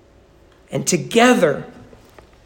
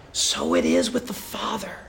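hushed, breathy whisper, much lower and softer; 9 dB quieter than usual. Real, not acted. Preacher whispering the lesson to the congregation. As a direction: lean in and whisper the point. Hushed climax of the lost-child illustration.

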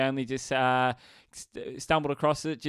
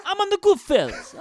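very high, loud mock mobster; shrieky hammed-up wise-guy impression; Joey Pesci; 6 dB louder than usual. Co-host riffing on a gangster film, high loud wise-guy voice. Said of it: "I'm Joey Pesci".

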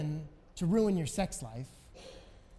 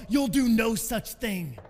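slow, loud snarling threat; 2 semitones higher, 7 dB louder, slower than usual. A narrator's reading. Ogre snarling at Jesus, slow and loud. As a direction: snarl it out slowly, like a chained beast. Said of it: "the ogre says with a snarl".

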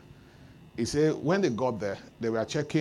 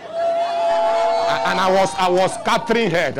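excited cry; 8 semitones higher, 8 dB louder, slower than usual. Acted. Preacher crying out excitedly.

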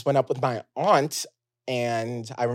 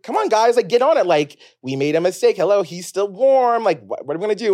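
high and loud, pushing everyone to act; urgent pleading; 6 semitones higher, 4 dB louder than usual. Retold or imitated. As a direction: plead with the family like a frantic kid. Child urging family to act.

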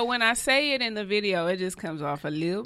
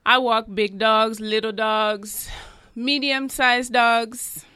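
put-on Jamaican accent. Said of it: "you have a Jamaican accent".

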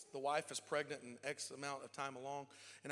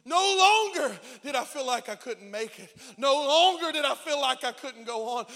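loud, high shouted refrain building; rising sermon climax; preaching at full force; 7 semitones higher, 13 dB louder than usual. Acted. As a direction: drive each No longer home.

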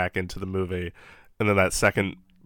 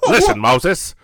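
loud, higher tough-guy gangster voice; gangster Dathan threat; 9 semitones higher, 6 dB louder than usual. Acted. Dathan threatens Moses over the staff, loud gangster snarl. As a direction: threaten Moses like a thirties mobster.

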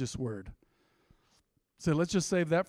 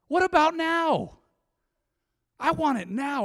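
much higher, louder and slow; preaching cry; 11 semitones higher, 6 dB louder, slower than usual. Real, not acted. Preacher crying out to the church, high, loud and slow. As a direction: cry it out at the peak.

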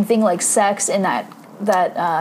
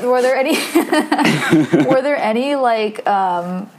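normal talking voice

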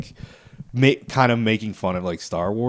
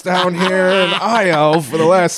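put-on high, slow old man voice; grumpy old man; 10 semitones higher, 4 dB louder, much slower than usual. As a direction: croak it out like a tired old man. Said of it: "Do old man voice?".

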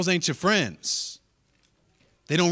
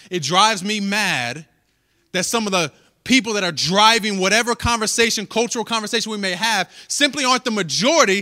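loud, rising, impassioned preaching; preaching at full force; 3 semitones higher, 5 dB louder than usual. Acted. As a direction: build the sermon up to a peak.

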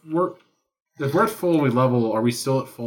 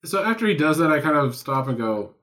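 normal talking voice

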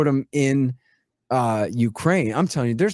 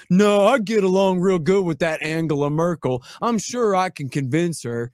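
mock Bush voice; 5 semitones higher, 4 dB louder than usual. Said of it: "he simply turns this to".

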